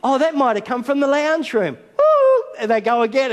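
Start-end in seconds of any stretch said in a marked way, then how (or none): 0.0-1.8 father pretending he heard a strange noise; mock puzzled. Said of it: "and you go". 2.0-2.4 very high, loud teasing woo-hoo; playful child hoot. Retold or imitated. Girls calling from hiding.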